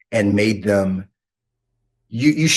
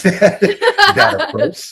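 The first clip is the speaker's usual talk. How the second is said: laughing; 5 dB louder than usual. Real, not acted. Host laughs about rude actors.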